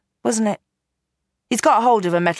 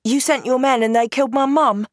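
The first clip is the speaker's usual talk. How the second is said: a touch higher, firm accusation; lightly voiced accusing girl; 7 semitones higher than usual. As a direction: throw it at him, cold and hurt. Girl accuses her mother's killer.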